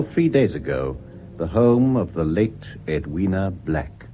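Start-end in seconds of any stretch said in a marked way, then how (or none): none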